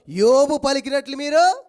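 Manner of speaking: slow, loud, booming rebuke; God rebuking. Acted. God rebuking Job's friends, slow and loud. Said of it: "యోబు స్నేహితులతో దేవుడు అంటాడు".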